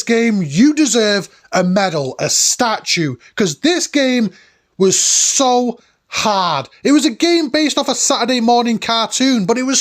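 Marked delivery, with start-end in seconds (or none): 3.4-6.7 "so" drawn out very slowly; stress on SO. Real, not acted. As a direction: stretch out "so" for emphasis. Emphatic complaint about difficulty.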